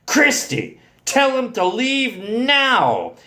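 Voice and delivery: high, drawn-out demand; shouted. A narrator's reading. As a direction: order her, stretching the last word. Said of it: "and shouted".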